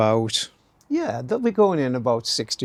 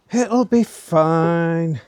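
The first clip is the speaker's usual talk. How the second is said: drawn out, louder, higher "It'll be fine"; mock reassuring voice; 4 semitones higher, 5 dB louder, much slower than usual. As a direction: say "It'll be fine" slowly in a silly put-on voice. Repeats line in put-on voice.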